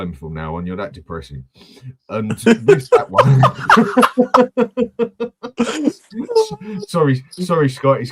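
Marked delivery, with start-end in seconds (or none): 2.1-3.4 slow words breaking into real laughter, voice jumping high and loud. Real, not acted. Laughing at his own joke. 3.5-6.8 a long high laugh, nearly crying; helpless high laugh. Real, not acted. Cracking up at own joke. 6.9-8.0 still laughing. Real, not acted. Apologising while still laughing.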